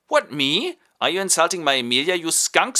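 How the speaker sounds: sneering courtier mimicking the groom; mocking echo. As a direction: throw his words back at him. Courtier mocks the groom back.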